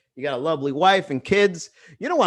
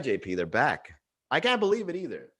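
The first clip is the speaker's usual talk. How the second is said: ordinary talk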